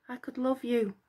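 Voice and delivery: soft, shy hopeful echo; gentle hopeful murmur. Acted. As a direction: echo him longingly. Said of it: "Echo repeated hopefully".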